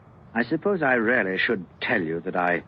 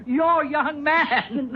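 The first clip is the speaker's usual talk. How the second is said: laughing, voice jumping much higher; incredulous laugh; much slower than usual. Acted. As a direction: laugh it out in disbelief. Laughs at her young man.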